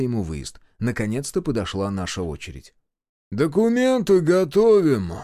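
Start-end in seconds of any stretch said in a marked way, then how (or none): 3.3-5.1 slow, drawled, pitched up; lazy, stretched-out official call; lazy request. A narrator's reading. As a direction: drawl it out like a bored gatekeeper. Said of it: "лениво попросил привратник".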